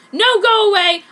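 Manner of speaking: high, loud refusal; angry refusal. A narrator's reading. Heroine refuses Aoyama's advance, loud. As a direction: send him off sharply. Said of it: "I said".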